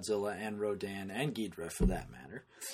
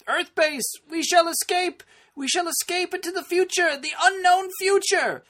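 very high, loud, hammed-up villain; dramatic villain speech; 10 dB louder than usual. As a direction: proclaim it like a doomed alien commander. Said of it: "in the Japanese version, he says".

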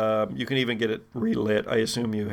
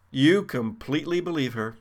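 normal talking voice

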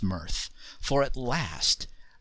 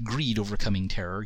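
ordinary delivery, nothing marked

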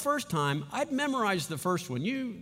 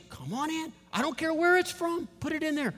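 much higher, delighted come-on-in; eager welcome; 10 semitones higher than usual. Pretend. Preacher playing a homeowner, delighted welcome. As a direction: throw the door open, thrilled. Welcoming good things into house.

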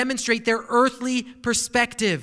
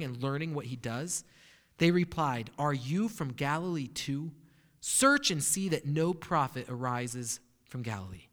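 scripture reading; 4 semitones lower than usual. Acted. Pastor reads the Scripture passage aloud.

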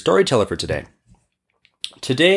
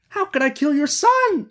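high-pitched, alarmed merchant; exclaiming merchant. Acted. Merchant denies killing the genie's son, high exclamation. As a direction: protest in a much higher put-on voice. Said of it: "exclaimed the merchant".

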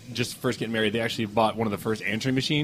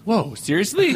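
higher-pitched acted disbelief; mock surprise; 7 semitones higher, slower than usual. Dad hears mom swear at the neighbor, mock surprise. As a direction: imitate a dad taken aback.

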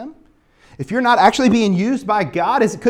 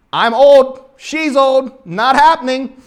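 loud, higher, emphatic objection; mock refusal; 5 semitones higher, 8 dB louder, slower than usual. Preacher as Zechariah doubting the angel, loud mock refusal. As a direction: shoot the idea down in loud, punchy phrases.

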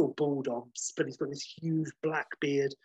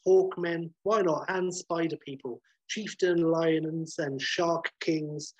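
usual speaking voice

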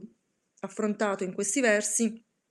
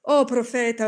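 louder, anguished outcry; shouted cry; 3 semitones higher, 8 dB louder than usual. Acted. Narrator screams at the raven, louder and anguished. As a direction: cry out to the bird in despair.

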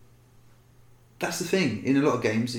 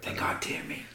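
whisper; 6 semitones lower, 8 dB quieter than usual. Pretend. Whispering to stay hidden in game.

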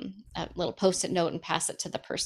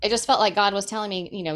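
ordinary talk

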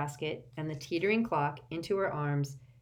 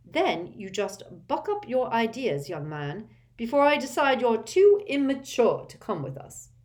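higher, louder scolding; angry mother; 6 semitones higher, 6 dB louder than usual. A narrator's reading. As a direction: snap at her young son. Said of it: "said Missus Weasley angrily".

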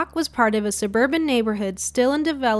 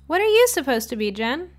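teasing friend; 5 semitones higher than usual. Acted. Friends greet in costumes.

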